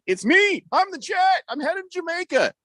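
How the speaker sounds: high excited yelling; mock scream. Host mimicking a listener's boastful text, mock screaming. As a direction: shout it like you can't contain yourself. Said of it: "when you screamed".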